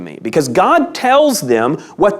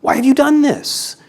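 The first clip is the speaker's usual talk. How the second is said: raised pitch, pleading reproach; reproachful question; 9 semitones higher than usual. Acted. Preacher reading God's rebuke to Israel, reproachful. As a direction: ask it as a pointed, high-pitched challenge.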